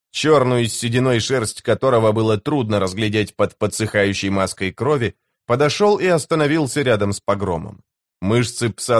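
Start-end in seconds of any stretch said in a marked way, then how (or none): none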